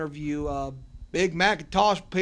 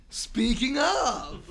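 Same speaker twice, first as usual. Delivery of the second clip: excited exclaim; 9 semitones higher, 4 dB louder, much slower than usual. Real, not acted. Ringtone interrupts interview.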